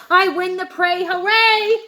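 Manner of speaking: loud, high victory whoop; triumphant cheer. Acted. Shark cheering after winning the snack, triumphant whoop. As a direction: celebrate winning the prize.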